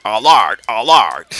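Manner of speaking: loud mimicry of an arcade voice; mocking game-voice impression. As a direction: parrot the game's repeated alert loudly. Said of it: "For my impression of stuff".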